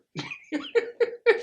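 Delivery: real burst of high laughter. Real, not acted. Preacher laughs at the Father's line, high burst. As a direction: laugh out loud at your own joke.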